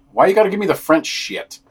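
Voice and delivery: ordinary talk, nothing marked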